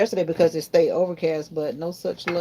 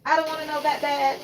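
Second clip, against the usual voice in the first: laughing repeat; 10 semitones higher than usual. Real, not acted.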